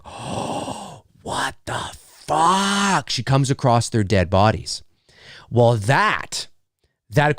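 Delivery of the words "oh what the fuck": slow, hushed whisper of dread; horrified whisper. Retold or imitated. Neighbor finding the dead bodies, slow horrified whisper. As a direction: breathe it out slowly, horrified. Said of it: "And it's like".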